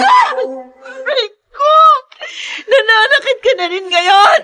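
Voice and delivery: high, loud, sobbing hurt; crying out in pain. Acted. Wife beaten by husband, sobbing cry of pain. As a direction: wail through tears as he hurts you.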